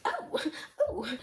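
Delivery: very high little yelps; acted high-pitched surprise; startled mother. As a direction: squeak as if bumped into. Mother startled by being bumped into.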